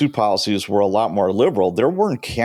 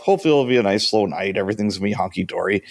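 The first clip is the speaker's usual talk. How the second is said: hopeful inner thought; 2 semitones lower, much faster than usual. Retold or imitated. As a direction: rattle off a wishful thought to yourself. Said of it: "So I'm thinking to myself".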